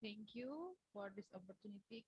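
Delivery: faint and far from the mic; speaking much too softly to be heard; barely audible. Real, not acted. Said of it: "Could you speak louder?".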